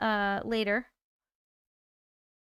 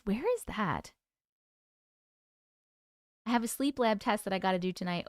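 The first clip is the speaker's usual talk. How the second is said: normal talking voice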